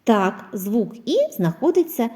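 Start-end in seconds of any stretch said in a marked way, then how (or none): none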